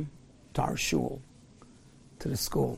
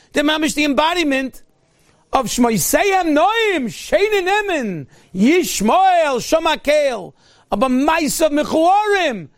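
raised, rhythmic pulpit delivery; fiery preaching; 7 semitones higher, slower than usual. Acted. Rabbi thundering to his congregation, fiery preaching. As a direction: preach it with mounting fervour.

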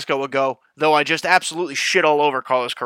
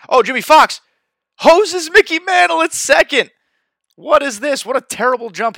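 high, loud shocked outburst; excited shout; 13 semitones higher, 10 dB louder than usual. Real, not acted. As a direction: yell it in disbelief. Shocked at a throw-out in game.

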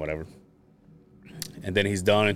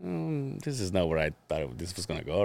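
soft, uneasy mutter; a hint of uncomfortable regret; awkward doubt; 3 semitones lower, 10 dB quieter than usual. Woman on stage realizing it's going wrong, quietly uneasy. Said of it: "her face started going like".

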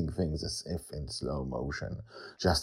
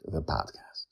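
plain reading voice

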